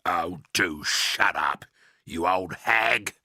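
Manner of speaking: slow, grumpy old man, lightly voiced; a sour husband brushing off his wife; Mr Twit voice. A narrator's reading. Husband telling wife to shut up, in a lightly voiced slow grumble.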